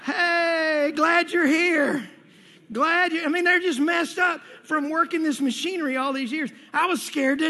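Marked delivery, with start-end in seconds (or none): 0.0-3.2 hearty coworker greeting. Retold or imitated. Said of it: "they're like".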